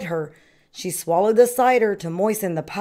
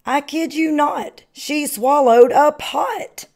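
higher and slower, louder on the pot; amazed exclaim; 8 semitones higher, 4 dB louder than usual. A narrator's reading. Reading aloud to children, high and amazed. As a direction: marvel at it, stretching it out.

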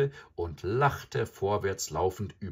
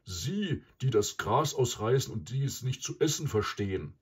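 higher, scornful buffalo voice; disdain, pitched up; contemptuous; 5 semitones higher, 3 dB louder than usual. A narrator's reading. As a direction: look down on them as the bull. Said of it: "sagte Mysa verächtlich".

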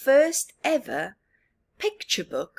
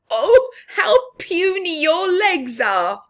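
high, loud jeering cry; shrill the insult out as a flock of birds; 11 semitones higher, 10 dB louder than usual. Acted. Other birds jeering at Bob's puny legs, loud high cry. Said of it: "cried the other birds".

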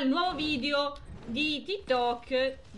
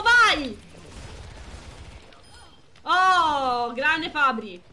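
excited cheer; 6 dB louder, much slower than usual. Real, not acted. Streamer cheering teammate Fabri's play, loud excited shout.

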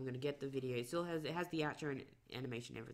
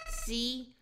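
higher, louder begging voice; desperate little flower pleading; 6 semitones higher, 7 dB louder than usual. Acted. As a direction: beg as if your life depends on it. Reads Flowey's pleading aloud.

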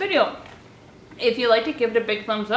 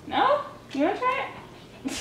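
dog-talk voice; 11 semitones higher, 6 dB quieter than usual. Real, not acted. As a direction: coax the dog in a high voice. Coaxing dog to taste food.

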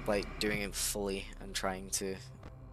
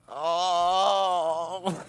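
startled cry; 8 semitones higher, 7 dB louder than usual. Real, not acted. Jump scare cry.

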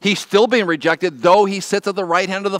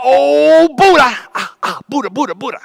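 loud high yelp fading to groans; hammed-up thumb-hit pain; mock pained cry; 8 semitones higher, 6 dB louder than usual. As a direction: pretend you hammered your thumb, crying Buddha. Mock swearing after hitting thumb.

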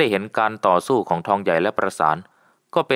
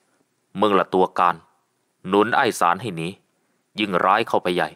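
ordinary delivery, nothing marked

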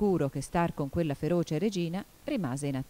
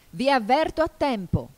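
high, loud royal warning; angry shout; 8 semitones higher, 7 dB louder than usual. A narrator's reading. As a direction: cry out an imperious threat. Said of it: "gridò la regina pestando il suolo". (grido imperioso della regina)

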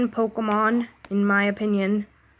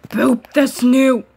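slow, louder cooing at a pet; 5 semitones higher, 9 dB louder, slower than usual. Real, not acted. Repeats in baby voice.

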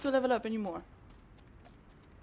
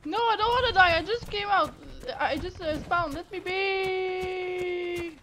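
scared pleading; 6 semitones higher than usual. Real, not acted. Pleading with the attacking creature.

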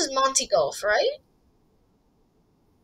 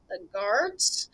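usual speaking voice

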